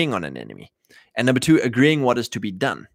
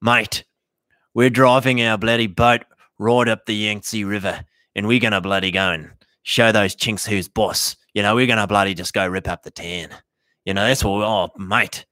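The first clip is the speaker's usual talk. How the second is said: put-on bogan Australian accent; 3 semitones lower than usual. Bogans boasting to the host about sailing up the Yangtze, in a broad Aussie accent. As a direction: play a swaggering Aussie larrikin. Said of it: "And then they're like".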